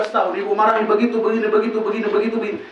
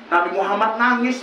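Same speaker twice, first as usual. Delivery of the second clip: normal talking voice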